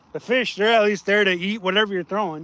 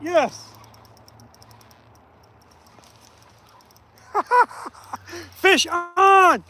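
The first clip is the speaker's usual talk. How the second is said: excited shouting; 6 semitones higher, slower than usual. Real, not acted. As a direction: yell it like you just hooked one. Hooking a fish on stream.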